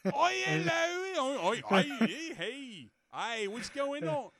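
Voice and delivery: high, laughing mock Cockney greetings; bad British accent. Imitating a friend's awful improv British accent. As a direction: ham up a terrible British accent. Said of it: "you just make Matt be British".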